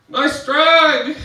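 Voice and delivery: high, loud holler over club music; shouted compliment. Retold or imitated. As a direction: yell it at someone in a noisy bar. Stranger yelling compliment in loud bar.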